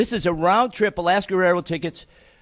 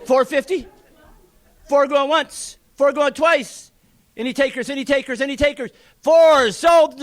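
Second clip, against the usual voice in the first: rapid, rhythmic auctioneer patter pushing the bids; auctioneer bid calling; 7 semitones higher than usual. Acted. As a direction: keep the numbers rolling like a real auctioneer. Closing the auction lot.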